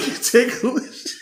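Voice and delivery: high squealing laughter; laughing. Real, not acted. Podcaster cracking up after his impression, laughing. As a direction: break up laughing. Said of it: "laughing at own impression".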